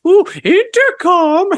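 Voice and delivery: high, drawn-out mocking "Ooh, intercom"; mock kids. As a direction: tease it like a schoolkid. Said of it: "And they're like".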